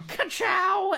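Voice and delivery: high car catchphrase yell; Ka-chow shout. Pretend. Lightning McQueen catchphrase shout.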